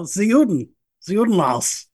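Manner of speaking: put-on German accent on the word; mock German accent. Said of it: "I promised to stop doing German accents".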